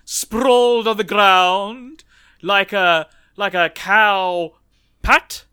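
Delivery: a hint of an irritated dragon; growling dragon guarding treasure. GM voices the dragon.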